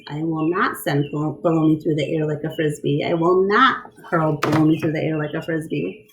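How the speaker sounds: ordinary delivery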